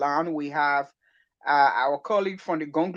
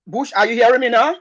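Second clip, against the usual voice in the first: raised, louder line check; calling out; 4 semitones higher, 7 dB louder than usual. Real, not acted. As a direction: call up to a caller on a bad line. Testing the line with returning caller.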